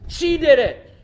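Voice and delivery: loud, high blurt of blame; acted outburst of accusation; accusing. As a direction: shift the blame onto her, forcefully. Adam blaming Eve before God.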